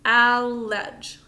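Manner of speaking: allege sounded out loud and slow, "al-ledge"; sounding out. Shown as a demonstration. Teacher leading class to sound out allege. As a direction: call out allege syllable by syllable. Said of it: "Sound it out with me".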